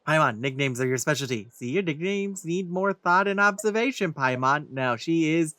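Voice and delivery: ordinary delivery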